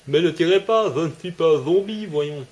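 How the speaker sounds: high, loud lisping Léon impression. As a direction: imitate a lisping dub actor, s said as th. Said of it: "il zozote, quoi".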